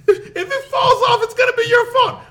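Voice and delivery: high, loud imitation of an angry wife; mad wife. Wife blaming him for the sticker, imitated in high anger. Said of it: "She got mad as fuck".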